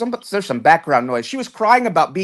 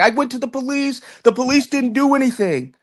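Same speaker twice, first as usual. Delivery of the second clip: mock whining; 6 semitones higher than usual. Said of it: "she was crying and whining".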